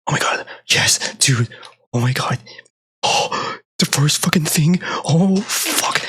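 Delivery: breathless, half-whispered excitement; hushed real shock. Real, not acted. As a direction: freak out while keeping it quiet. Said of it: "talking a bit quiet and soft".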